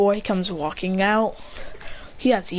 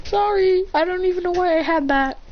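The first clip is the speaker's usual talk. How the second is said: high-pitched sheepish excuse; acted kid apology, pitched up; 9 semitones higher than usual. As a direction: plead innocence in a raised voice. Culprit apologizes.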